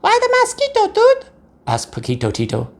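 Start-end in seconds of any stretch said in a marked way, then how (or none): 0.0-1.3 very high squeaky tiny voice; tiny chihuahua. A narrator's reading. Smallest chihuahua Paquito Tito asking about the mask, very high squeak. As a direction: squeak it like the littlest pup. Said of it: "the smallest of the small ones spoke up".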